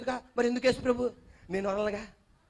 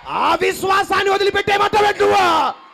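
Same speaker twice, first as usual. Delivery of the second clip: shouted, higher challenge to the crowd; preaching shout; 9 semitones higher, 10 dB louder than usual. Acted. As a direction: hurl the question at them. Preaching at full force.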